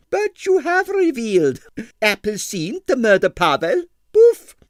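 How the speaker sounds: high scornful "Poof!" burst; scoffing disbelief. Acted. Impresario scoffing at Stanley's suspect, high and loud. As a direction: scoff at the very idea.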